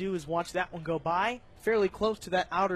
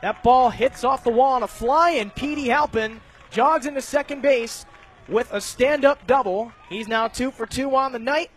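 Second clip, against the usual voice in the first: louder and higher excited play-by-play on a long drive; excited call; 4 semitones higher, 6 dB louder than usual. Real, not acted. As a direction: build the call as the ball heads to the wall. Ball off the wall, live call.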